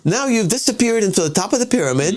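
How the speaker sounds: lightly acted neighbor accusing a representative; aggrieved constituent. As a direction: put the complaint up high, without shouting. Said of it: "I'm yelling at you".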